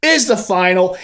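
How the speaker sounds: ordinary talk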